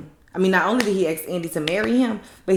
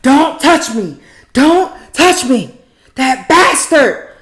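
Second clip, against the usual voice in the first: loud, high mock yelling; hammed-up furious yell; angry yell; 6 semitones higher, 12 dB louder, slower than usual. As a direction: shriek it like she's losing it. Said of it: "She like".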